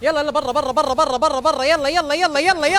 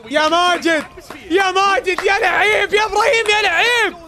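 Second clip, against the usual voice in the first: cheering names; 6 semitones higher than usual. Real, not acted. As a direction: hype each player up. Calling players' names, cheering.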